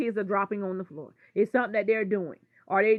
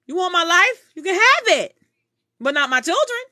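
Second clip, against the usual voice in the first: much higher and louder, fierce; defiant shout; 9 semitones higher, 9 dB louder than usual. Real, not acted. To the woman who wants her life, a defiant shout.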